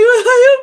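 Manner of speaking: high burst of a half-laugh, half-sob; high-pitched sobbing laugh; retold sob-laugh. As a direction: break into a teary high cry. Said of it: "I start bawling".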